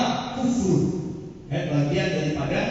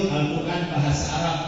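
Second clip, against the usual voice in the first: ordinary talk, nothing marked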